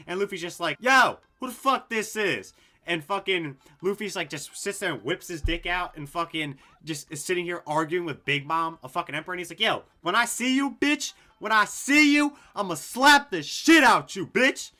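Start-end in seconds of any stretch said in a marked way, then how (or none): 0.8-2.5 loud, higher, drawn-out mock challenge; brash confrontation. Acting out Luffy confronting the newcomers. 9.6-14.7 high, loud hammed-up shouting threat; mock threat. Acts out Luffy threatening Big Mom.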